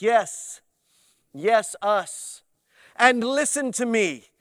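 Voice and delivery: swelling into a grand prayer voice; mock pompous prayer. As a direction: lampoon the self-important pray-er. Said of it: "the voice changes".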